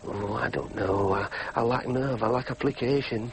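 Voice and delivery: low, heavy musing; dejected self-reproach. Acted. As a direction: blame yourself gloomily, pitch sunk. Alone, blaming himself.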